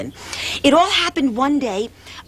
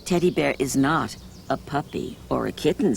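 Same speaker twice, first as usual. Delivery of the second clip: mother; 8 semitones lower, 5 dB quieter than usual. Acted. Mother voiced, low and soft.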